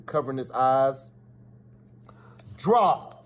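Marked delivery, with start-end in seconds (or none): 2.6-3.1 loud, high call of draw; commanding call. Acted. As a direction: bark the start command. Command to start the duel.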